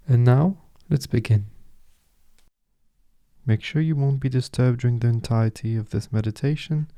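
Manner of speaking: soft, unhurried meditation guide, with long pauses; softly spoken guide leaving space between phrases; guided meditation. Acted. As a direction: settle the listener gently into stillness.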